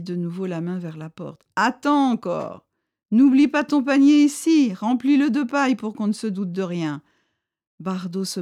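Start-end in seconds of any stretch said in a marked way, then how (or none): 1.6-7.0 louder, higher stern warning; chief's voice. A narrator's reading. Chief warns Bardot to swear secrecy, louder and higher.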